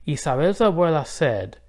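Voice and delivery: plain reading voice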